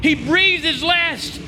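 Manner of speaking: soaring, high shouted preaching refrain; preaching at full force. Acted. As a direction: build the congregation up to Sunday's victory, shouting. Preaching climax, Friday/Sunday refrain.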